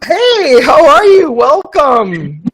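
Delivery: high, loud, effusive welcome; excited greeting. Real, not acted. Host welcoming the first guest on air, excited. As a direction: greet them at full excited pitch.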